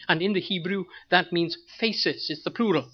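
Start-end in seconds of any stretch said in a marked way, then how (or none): none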